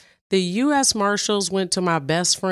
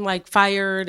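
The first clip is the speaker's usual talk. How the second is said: normal talking voice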